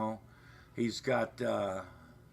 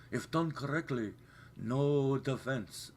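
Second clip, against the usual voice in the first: slow movie-mentor quote, raised in pitch; Karate Kid quote; 4 semitones higher than usual. Retold or imitated. Quoting the Karate Kid to viewers, slow film impression. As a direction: imitate the film line, drawing out the last words. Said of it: "Karate Kid, you know".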